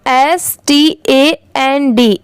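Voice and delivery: slow, letter by letter; spelling out letters. Shown as a demonstration. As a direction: spell each letter out slowly. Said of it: "इसे क्या पढ़ेंगे?".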